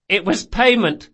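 put-on high, loud earnest boy; distressed boy Galdo. Galdo to old woman, high urgent explaining.

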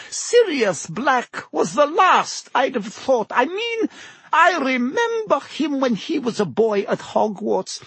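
high-pitched character voice of a chatty landlady; musing landlady, disbelieving. A narrator's reading. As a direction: reminisce incredulously in a high voice. Narrator voices Madame Rosmerta.

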